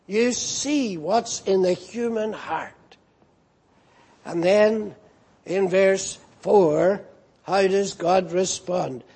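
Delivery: ordinary talk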